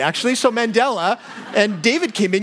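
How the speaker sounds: usual speaking voice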